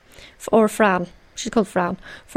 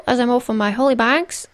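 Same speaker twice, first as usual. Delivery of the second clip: usual speaking voice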